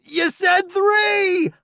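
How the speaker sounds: high, drawn-out yell; shouted protest. A narrator's reading. Newbie darting after Roddy, shouted protest. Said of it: "I protest".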